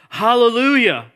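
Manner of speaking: high, loud ringing shout; crying out hallelujah. Acted. Pastor voicing heavenly multitude to congregation, shouting. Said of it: "once more they cried out".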